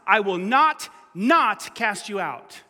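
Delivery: "not" punched twice, slowly; stress on both nots. Shown as a demonstration. Pastor showing the double negative to the church, slow and firm. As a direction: press each "not", then slow down on cast you out. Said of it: "there's two nots".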